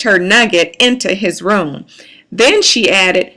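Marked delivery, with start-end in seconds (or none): none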